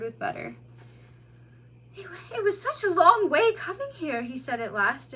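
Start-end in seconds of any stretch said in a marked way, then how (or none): 2.0-4.2 high, small, pitiful voice; pathetic. A narrator's reading. Exhausted otter telling the mole, pathetic. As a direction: plead like a worn-out child. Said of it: "and most pathetically".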